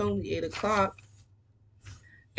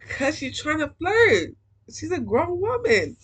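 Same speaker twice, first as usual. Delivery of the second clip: outraged; 9 semitones higher, 6 dB louder than usual. Real, not acted. Reader objects to Mom flirting, high loud outrage. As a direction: cry out in high indignation.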